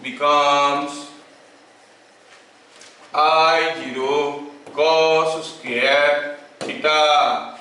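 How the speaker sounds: word by word, very slow dictation with long pauses; teacher dictating a law for notes. Acted. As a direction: dictate to students taking notes.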